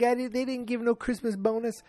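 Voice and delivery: very high indignant protest; Archie Bunker. Retold or imitated. Host as husband denying the bonus, indignant impression. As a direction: sputter in a put-on grouch voice. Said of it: "He's like".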